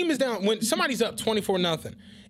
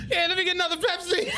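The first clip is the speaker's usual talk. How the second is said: laughing mock order; 10 semitones higher than usual. Mocking a cheap order at the club, laughing high. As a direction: shout it through laughter. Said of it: "I'm just like".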